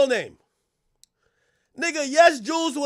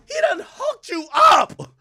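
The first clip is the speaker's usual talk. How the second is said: mock high voice; 11 semitones higher, slower than usual. Mocking [name]'s lyric in a put-on voice.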